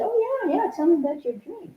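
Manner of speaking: high, lightly mocking therapist voice; a sing-song imitation of the eager therapist; imitating the therapist. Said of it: "She said".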